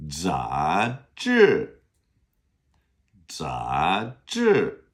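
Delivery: 杂志 rising, then falling sharply; second then fourth tone. Shown as a demonstration. Teacher drilling magazine, rising then falling tones. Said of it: "fourth tone".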